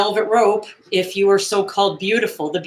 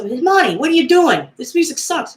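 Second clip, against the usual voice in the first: Tony complaining; 9 semitones higher than usual. Retold or imitated. Tony yelling at the DJ about the music, high and indignant. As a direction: protest across the room. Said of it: "he goes".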